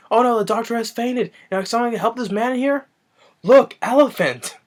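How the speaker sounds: high, hammed-up alarmed voice; mock alarm. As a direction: call it out in fake panic. Acts out fake alarm, distraction.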